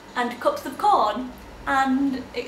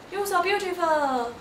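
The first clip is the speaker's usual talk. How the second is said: much higher adoring praise; adoring strangers; 9 semitones higher, slower than usual. Retold or imitated. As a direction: gush at her in the street. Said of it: "or going".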